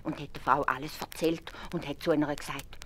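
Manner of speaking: usual speaking voice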